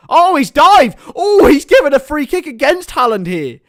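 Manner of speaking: high, loud commentator outburst; excited shout. Real, not acted. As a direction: shout it in real disbelief. Commentator reacts to surprise free kick.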